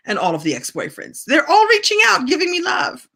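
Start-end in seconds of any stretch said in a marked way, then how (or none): 1.2-3.1 high, loud, playful crowing; gleeful exclamation. Real, not acted. Host boasting that all the exes are reaching out, high gleeful shout. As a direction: crow it out, pitch soaring.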